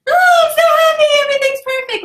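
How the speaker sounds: mock happy. Mocking people who pretend to be always happy, hammed-up squeal. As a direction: overact a giddy fake-positive person. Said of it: "you walk around like".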